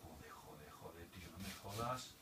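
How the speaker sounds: muttered curses, barely audible; cursing under breath. Real, not acted. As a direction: swear quietly to yourself. Muttering in fear of the VR drop.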